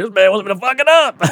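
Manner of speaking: high, loud imitated announcement; hollering from stage. Performer tells the crowd about the fan, hollering.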